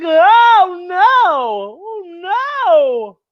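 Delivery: high, squeaky mock panic; scared monsters. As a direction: cower like frightened monsters. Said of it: "all the monsters are like".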